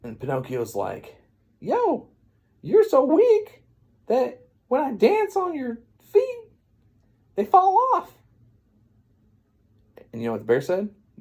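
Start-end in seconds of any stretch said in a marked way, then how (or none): 1.6-8.1 taunting brag. Retold or imitated. Pinocchio insulting the bear in battle, high taunting. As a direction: pitch the insult up high and milk it.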